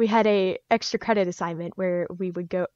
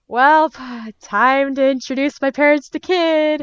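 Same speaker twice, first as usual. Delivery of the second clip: loud, higher, mock-heroic announcement; resigned bravado; 6 semitones higher, 6 dB louder, slower than usual. Teen deciding to introduce parents to the fursuit, loud and mock-grand.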